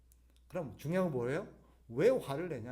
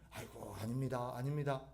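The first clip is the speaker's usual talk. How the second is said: low, softer mock-modest demurral; feigned humility; 5 semitones lower, 4 dB quieter than usual. Preacher mimicking someone pretending to be humble, feigned humility. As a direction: act out falsely humble polite refusals. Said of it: "겸손한 척".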